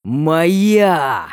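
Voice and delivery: possessive roar; roaring growl. A narrator's reading. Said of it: "Рычу так громко".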